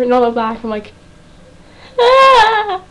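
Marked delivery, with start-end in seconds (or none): 2.0-2.8 high, drawn-out "ah"; hammed-up fangirl scream; mock scream. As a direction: let out a high mock shriek. Acting out shocked reaction to show.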